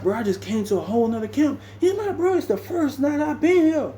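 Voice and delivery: very high pitched retold voice; high mock voice of the newcomer; retold complaint. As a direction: push the pitch up sharply, bewildered. New arrival's complaint.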